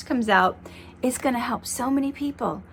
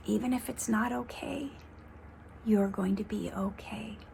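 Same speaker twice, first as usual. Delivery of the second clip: whispered, much softer reassurance; 11 dB quieter than usual. Real, not acted. She whispers the reassurance to her viewers, soft and tender. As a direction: breathe it to them as a quiet comfort.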